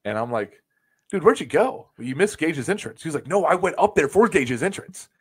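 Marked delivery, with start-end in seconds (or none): 1.1-3.0 mildly exasperated, higher-pitched retold question; incredulous question. Asking a friend who ran off where he went, incredulous. 3.3-5.1 higher, louder retold comeback; insistent protest. Friend explaining he ran up for the entrance, insistent.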